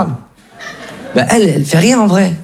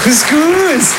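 high mock echo; 10 semitones higher than usual. Mock dread echo of mother.

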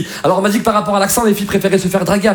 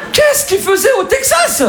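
very high, slow yell; high-pitched drawn-out outcry; incredulous shout; slower than usual. Acted. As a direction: shriek the question in disbelief, stretching it. Shouted disbelief at Algerian in Texas.

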